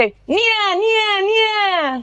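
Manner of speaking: nasal twang nya; twangy nya. Shown as a demonstration. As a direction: pinch into twang. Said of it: "give me, like, a yeah".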